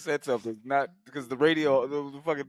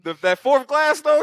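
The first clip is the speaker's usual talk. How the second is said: higher and louder, building hype; excited retelling; 12 semitones higher, 8 dB louder than usual. Retold or imitated. Friend hyping the fourth pane of glass. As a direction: ramp up as the beating peaks. Retelling Clarence getting thrown.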